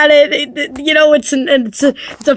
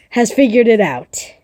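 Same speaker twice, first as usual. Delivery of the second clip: usual speaking voice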